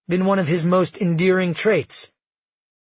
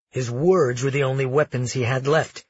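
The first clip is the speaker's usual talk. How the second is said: ordinary delivery, nothing marked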